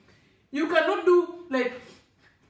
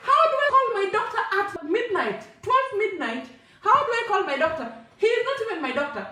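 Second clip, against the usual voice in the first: high, incredulous retold objection; exasperated protest; 7 semitones higher than usual. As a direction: protest helplessly in a raised pitch. Acts protest at calling doctor.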